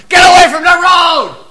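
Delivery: put-on yelling at a child near the road; parent's shout. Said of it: "they yell out the window".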